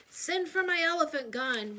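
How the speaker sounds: loud, booming roar. A narrator's reading. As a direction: bellow the order. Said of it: "roared Uncle Theodore".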